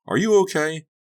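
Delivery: high, loud worried call; alarmed. Acted. Jenny runs after fallen Donnie, high alarmed call. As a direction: check urgently on a fallen child.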